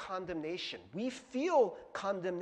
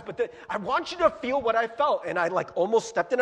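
ordinary talk

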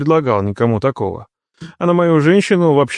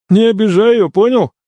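higher-pitched, insistent demand; 8 semitones higher, 4 dB louder than usual. A narrator's reading. Brother-in-law warns the new husband, demanding. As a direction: press for a promise, voice lifted. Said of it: "потребовал Дияр".

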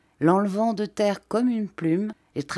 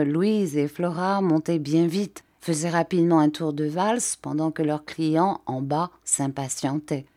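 plain reading voice

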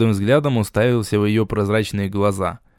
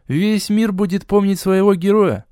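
higher mocking echo of her; 9 semitones higher than usual. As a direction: parrot her words with scorn. Hero mocks goddess's words.

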